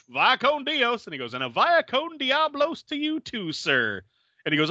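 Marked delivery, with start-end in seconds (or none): 0.1-1.0 cheery farewell. Retold or imitated. Roach farewell. 1.3-4.0 higher, sing-song sarcasm; a sweetly mocking goodbye; lightly voiced smug villain; mock polite reply.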